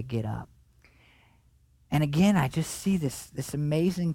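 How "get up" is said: much softer, lower and tender; Jesus softly waking the little girl; intimate, compassionate. Retold or imitated. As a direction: say it gently to a dead child you love. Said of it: "in a very intimate, compassionate way".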